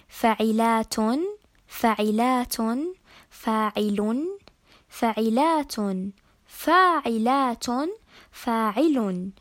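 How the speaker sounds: slow, measured foot names with long vowels and clear gaps; metrical foot recitation. Shown as a demonstration. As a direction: sound out each foot, stretching "فاعلات" and "فاعل". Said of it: "نقسمها حسب التفعيلة".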